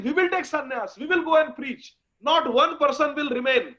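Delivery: high-pitched, emotional begging; tearful pleading. Acted. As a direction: beg through tears, loud at first, then falling back. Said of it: "They are crying. They are saying".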